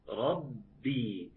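ordinary talk, nothing marked

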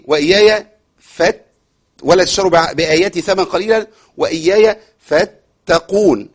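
slow measured tajweed chant; Quran recitation. Shown as a demonstration. Teacher reciting the verse slowly for students. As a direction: recite it slowly, drawing out each word. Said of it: "الأفضل إن أنا أقف على إيه".

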